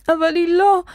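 high, upset sniffly protest; distraught, near-crying objection; tearful protest. A narrator's reading. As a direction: protest through tears, pitched well up. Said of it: "משך נוח באפו".

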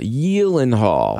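slow "Gyllenhaal" as YEEL-en-hall; Swedish Y sound. Shown as a demonstration. Host repeating the Swedish name, Swedish Y sound. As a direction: stretch "Gyllenhaal" with a Y for the G. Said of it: "The G is pronounced as a Y".

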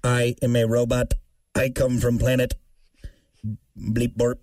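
low, deadpan mock robot. Co-host doing a silly robot voice. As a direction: announce yourself like a toy robot. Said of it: "No, that's an alien".

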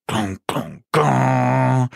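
three gongs, the last held long; imiteret quiz-gong. Retold or imitated. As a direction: voice a game show's suspense sting. Efterligner quizzens gong. (efterlignet gong, sidste slag langt)

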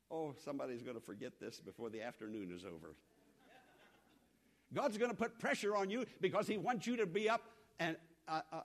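ordinary talk, nothing marked

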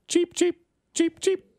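high squeaky chirping bird. Pretend. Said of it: "What do birds say when they fly over Kmart".

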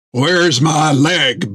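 slow, heavy pirate voice demanding his leg; the one-legged giant Jack wakes and demands his leg, slow and heavy; gruff pirate giant. Acted.

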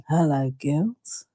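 low, slow, soft sleazy greeting; creepy guy. Retold or imitated. Creepy mall guy calls out to two passing women, low and slow. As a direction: lean in like a creepy mall salesman. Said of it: "this guy was real creepy".

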